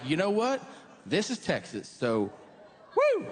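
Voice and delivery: mock Texan, ending in a yelped woo; swaggering cowboy that whoops at the end; Texan bravado. Comic imitating Texans, swaggering into a whoop. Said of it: "they're like".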